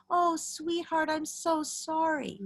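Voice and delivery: high, slow, syrupy "Oh, sweetheart, I'm so sorry"; exaggerated sugary pity, drawn out; overly sweet to a child. Shown as a demonstration. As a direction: coo it like to a small child.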